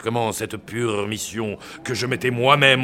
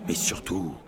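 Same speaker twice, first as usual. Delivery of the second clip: usual speaking voice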